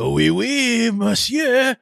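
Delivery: hammed-up, high-pitched French accent; cartoonish French accent on a high swoop; over-the-top French accent. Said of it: "a French accent, you know, a mustache twirling, villainous French accent".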